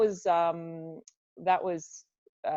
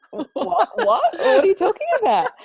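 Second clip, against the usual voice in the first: much higher, louder mock-baffled cry through a laugh; baffled disbelief; 9 dB louder than usual. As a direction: act out your thirteen-year-old self's amused confusion. Her retold baffled reaction.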